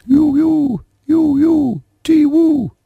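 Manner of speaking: very high sustained bird call; long sad bird cry. A narrator's reading. Night bird crying over the brook, very high sad call. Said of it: "gave a long sad cry".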